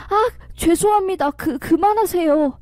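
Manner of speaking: high, stammering mock plea; pleading cry. A narrator's reading. Caught lover begging the husband to stop, high pleading cry. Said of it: "라고 소리치며".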